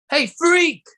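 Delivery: high, loud jeer; mock bully. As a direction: heckle Spider-Man like a bully. Bully taunting Spider-Man.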